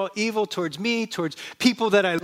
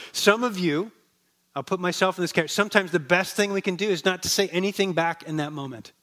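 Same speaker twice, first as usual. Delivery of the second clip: normal talking voice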